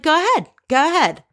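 high, taunting dare; mocking taunt. Mario daring Jill to punch him, mocking taunt. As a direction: egg her on, sneering.

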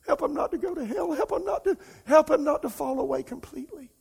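high, tearful cry for help; weeping prayer. Retold or imitated. Preacher reliving praying in tears for his friend, high weeping plea. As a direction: cry out to God for a friend, voice breaking. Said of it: "I just called out".

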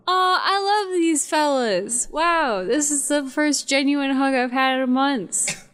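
high-pitched, drawn-out gushing; touched, gushing. Acted. Fairy hugged by two teddies, high touched gushing. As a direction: melt into it, slow and high.

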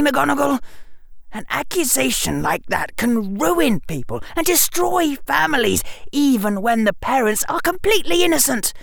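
high-pitched furious warning, building; anger climbing out of control. A narrator's reading. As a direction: threaten in outrage, breaking between phrases.

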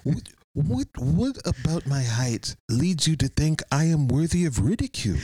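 indignant. Acted. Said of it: "I guess I didn't think it was ridicule".

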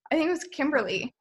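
ordinary talk, nothing marked

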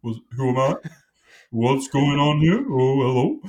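slow, vacant dopey dog, words drawn out; dim Labrador voice. Acted. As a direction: sound like a dog who stares at walls, dazed and puzzled. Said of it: "he's kind of got a voice like".

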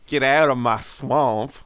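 slow Southern drawl. Pretend. Bigfoot growls at intruders in his swamp, slow Southern drawl. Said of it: "Southern Bigfoot's got that slow drawl".

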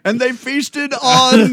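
high, loud, slow mock epic narration; hammed-up grand storyteller; Monty Python narrator. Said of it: "Monty Python's Holy Grail".

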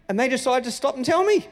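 ordinary delivery

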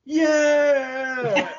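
high cheering yell; crowd cheer. Retold or imitated. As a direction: cheer like a whole arena. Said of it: "the crowd's like".

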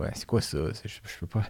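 soft unvoiced whisper of dismay; whispered panic. Recalling his dread at the assigned topic, whispered.

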